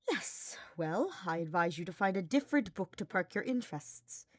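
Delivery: ordinary delivery, nothing marked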